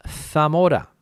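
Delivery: Zamora starting with th, "Thamora"; Castilian th. Shown as a demonstration. Teacher gives another place, Zamora with th. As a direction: say the Z of Zamora like th in think. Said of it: "Another place".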